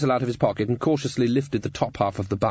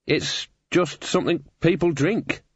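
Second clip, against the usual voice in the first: slow, slightly higher, noncommittal; vague; 6 semitones higher, slower than usual. A narrator's reading. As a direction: answer vaguely, drawing it out. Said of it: "he said vaguely".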